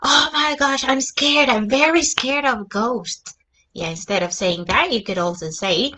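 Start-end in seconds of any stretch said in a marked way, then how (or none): 0.1-3.3 gasp, then loud scared exclamation; acted fright. Shown as a demonstration. Teacher acting out fear of ghosts for students, hammed-up fright. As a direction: play scared with a loud, startled burst. Acts out example of being scared.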